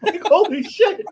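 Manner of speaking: high, slow laugh-filled holy shit; cracking up while saying it. Real, not acted.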